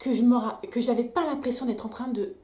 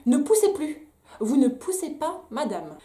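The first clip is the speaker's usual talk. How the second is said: higher, louder imitation of the midwife's command; mock stern order; 4 semitones higher, 5 dB louder, slower than usual. Mother imitating the midwife telling her not to push, mock stern. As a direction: bark the order like a strict midwife. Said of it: "elle me dit".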